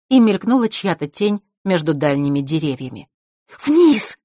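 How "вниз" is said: high, loud urgent order; sharp command. A narrator's reading. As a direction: snap the order out loudly. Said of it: "скомандовала Василиса".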